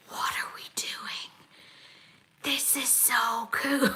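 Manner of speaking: hushed amazed whisper, slowing at the end; awed whisper. Retold or imitated. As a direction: whisper it like you can't believe how cool it is. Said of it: "I was like".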